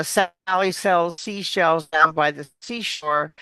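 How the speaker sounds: slow, careful tongue twister. Shown as a demonstration. Coach demonstrating a tongue twister, slow and careful. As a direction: enunciate each word slowly. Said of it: "you're saying".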